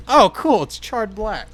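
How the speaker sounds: high and loud mock cheer; sarcastic. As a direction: fake cheer about a disaster. Retelling the burnt label.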